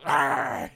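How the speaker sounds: loud, low growling bark; imitated dog snarl. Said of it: "And the dog".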